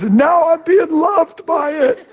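high, slow son's voice with a laugh. Retold or imitated. The son to his dad, slow, high and laughing. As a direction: draw it out high, laughing through it. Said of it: "what he was trying to say is".

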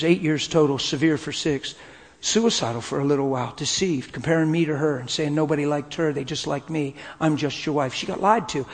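ordinary delivery

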